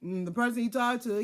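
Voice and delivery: ordinary talk, nothing marked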